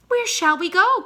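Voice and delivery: high, loud eager question; babies crying out. A narrator's reading. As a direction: shout it like excited cygnets. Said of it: "cried all of the babies".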